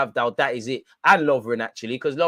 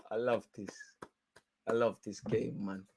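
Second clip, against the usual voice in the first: quiet savouring; 5 semitones lower, 11 dB quieter than usual. Real, not acted.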